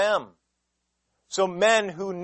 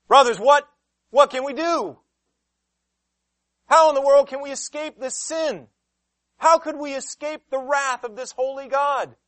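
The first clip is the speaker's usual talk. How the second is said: high, loud anguished pleading; desperate cry; 8 semitones higher, 8 dB louder than usual. Acted. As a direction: cry out for rescue. Crowd crying out in anguish.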